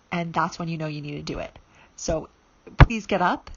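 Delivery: normal talking voice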